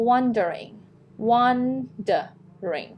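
"wondering" slowly, opening on a strong WON; emphasised word. Shown as a demonstration. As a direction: break "wondering" down with a stressed first syllable. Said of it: "คำที่เราจะเน้น".

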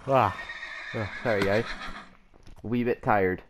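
usual speaking voice

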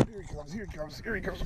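very soft, slightly high chant; quiet, urgent anticipation; hushed excitement. Real, not acted. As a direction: say it far under the voice, keyed up. Fish being reeled in.

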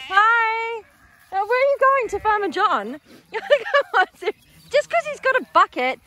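high, playful cooing. Real, not acted. Farmer teases lambs chasing the bucket, high and cooing.

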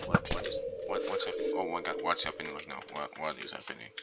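low, soft, bewildered murmur; real quiet unease; hushed alarm. Real, not acted. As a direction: murmur it, unsettled. Something strange is happening.

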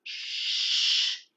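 a sharp whispered shh; hissed hush. Acted. Baby bird hushing his siblings as Mama arrives, whispered hiss. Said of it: "hissed Pip".

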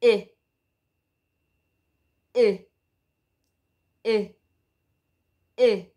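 clipped short vowel I said as in "it"; short i sound. Shown as a demonstration. Teacher modelling the short vowel for children, clear and deliberate. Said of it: "The short sound of letter I is".

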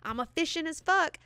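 high, loud, gloating boast; a high-pitched mock brag; mock smug rider. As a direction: play the smug scooter rider crowing about speed. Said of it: "and you're like".

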